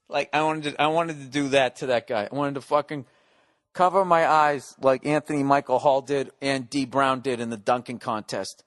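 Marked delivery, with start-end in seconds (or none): none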